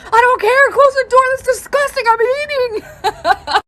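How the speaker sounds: very high, loud mock outrage; disgusted yelling. Husband trying to eat, yelling in disgust. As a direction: shriek it, dragging out the end.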